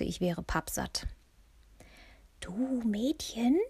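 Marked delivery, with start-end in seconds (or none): 2.4-3.6 slow, hushed call for attention; whispered. A narrator's reading. Ulla beckons the narrator over, half-whispering. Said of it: "flüsterte Ulla".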